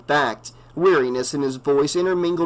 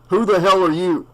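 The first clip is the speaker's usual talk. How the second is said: louder, higher challenge; sharp demand; 5 semitones higher, 5 dB louder than usual. A narrator's reading. The sheriff confronts the reverend, sharp. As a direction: bark at the stranger.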